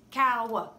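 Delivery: "Cow" broken into two beats, "cow-wuh"; wrongly split syllable. Shown as a demonstration. Teacher showing the mistake, "Cow" split as "cow-wuh". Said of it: "we don't say it like this".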